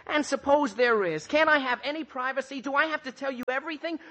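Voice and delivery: worked-up and defensive; indignant outburst. Acted. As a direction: fire off defensive questions, voice climbing to the end. Archie indignant at being questioned.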